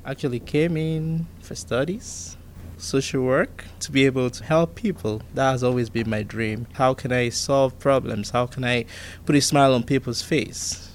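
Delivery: ordinary talk, nothing marked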